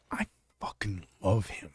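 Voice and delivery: breathy whisper, almost secret; hushed, intimate admission; whispered confession. Real, not acted. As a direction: let it slip in a hushed whisper. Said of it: "I'm gonna tell you something".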